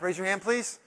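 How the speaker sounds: ordinary delivery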